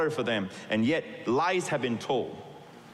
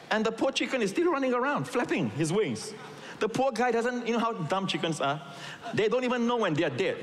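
much higher, amused storytelling; amused, excited telling; 9 semitones higher than usual. Real, not acted. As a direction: tell it with comic glee. Comic chicken story, voice up.

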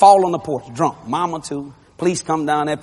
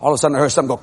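ordinary delivery, nothing marked

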